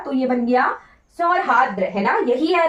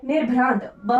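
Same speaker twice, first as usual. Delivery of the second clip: ordinary talk, nothing marked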